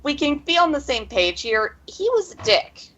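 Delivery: high-pitched blunt character voice; Iolana. Acted. Bluntly judging their old master to them, high-pitched. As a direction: pitch it up, climbing on the verdict. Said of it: "then Iolana says".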